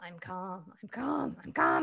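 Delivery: tightly held-in calm, repeated like a mantra; trying to convince yourself; forced calm. Acted. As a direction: insist you're calm while about to lose it. Forcing calm before losing it.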